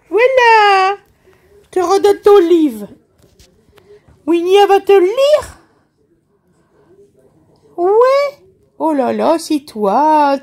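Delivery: put-on mother cooing to her baby over his first book; baby talk. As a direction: gush up high over the book. Mother coos over baby's book.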